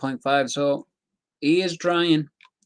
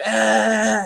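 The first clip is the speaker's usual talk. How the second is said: high, loud mock charging cry; sustained high yell, louder, imitating a bull; 10 semitones higher, 5 dB louder than usual. Imitating the baited fighter rushing in, mock charging yell. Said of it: "all the ball has to go is".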